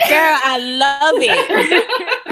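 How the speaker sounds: slow, laughing delight. Real, not acted. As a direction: laugh it out fondly. Reacting with delight to accent.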